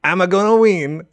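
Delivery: high, loud mock Italian cartoon accent; playful Mario-style Italian accent; Mario impression. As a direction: bounce it like a cartoon plumber. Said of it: "the main thing ... think of him saying".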